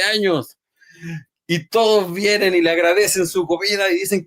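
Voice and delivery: high, emphatic confession; husband's earnest outburst. Retold or imitated. Husband confides in the preacher, high and emphatic. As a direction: pour it out with relief.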